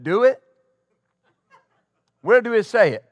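a hint of the old pastor's way of speaking, blunt challenge; lightly voiced old pastor asks where it is written; imitating Pastor Morton. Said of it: "That's how he spoke".

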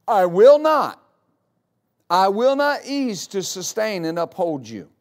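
I WILL NOT punched loudly, word by word; emphatic. Retold or imitated. Preacher quoting God's promise, emphatic on WILL NOT. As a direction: hammer WILL NOT, then ease off for the rest. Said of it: "He, he emphasizes that".

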